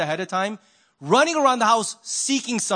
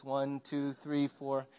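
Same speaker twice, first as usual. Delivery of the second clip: cheater's count; 6 semitones lower, 10 dB quieter, much slower than usual. Retold or imitated. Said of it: "The cheater's always like this".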